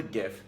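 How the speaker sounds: gif with a soft g, like jif; soft G pronunciation. Shown as a demonstration. Reader quotes the inventor's way, soft-g gif. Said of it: "says it's pronounced gif".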